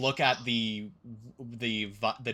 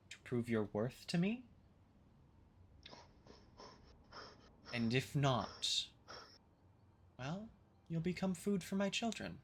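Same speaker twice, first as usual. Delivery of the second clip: softer, unhurried menace with long pauses; ancient forest entity, quietly threatening; 3 semitones higher, 8 dB quieter than usual. Acted. Entity warning intruders they may become food, quietly threatening. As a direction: deliver the threat gently and matter-of-factly.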